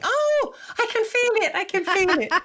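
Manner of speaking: high, excited mock student squeal. Energy healer mimics an excited chakra class student, high and thrilled. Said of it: "I'm gonna get someone going".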